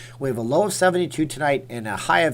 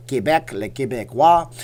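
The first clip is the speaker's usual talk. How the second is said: louder, put-on Québécois French accent; 5 dB louder than usual. Streamer naming the province of Quebec, playful French accent. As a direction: say it with a French flourish.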